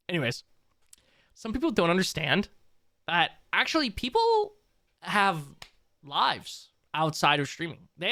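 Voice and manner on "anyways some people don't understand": ordinary talk, nothing marked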